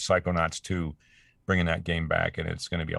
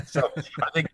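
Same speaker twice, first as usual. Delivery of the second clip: laughing; 9 semitones higher, 3 dB louder than usual. Real, not acted. Laughing at his own story.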